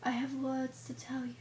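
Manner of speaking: soft, solemn hush; mock whisper. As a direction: imitate a hushed fantasy elder, very quietly. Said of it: "why the fuck you whispering?".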